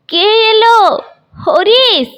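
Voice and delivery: put-on high, loud old man asking who came; old grandfather calling out. Old grandfather calling out to his son, high and loud. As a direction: perk up and call for your son.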